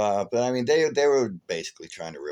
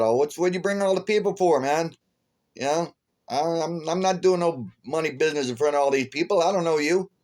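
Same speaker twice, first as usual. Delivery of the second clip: higher, heated protest; indignant protest; 7 semitones higher than usual. Retold or imitated. To the car sellers, a higher heated protest. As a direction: push back at the strangers.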